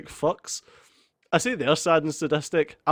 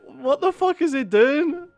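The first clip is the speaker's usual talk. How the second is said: incredulous; 9 semitones higher than usual. Retold or imitated. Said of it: "I was like".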